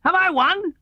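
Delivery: cheerful Tigger. A narrator's reading. Tigger emerges from the tablecloth, cheerfully. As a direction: pop up proud from the struggle. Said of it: "said cheerfully".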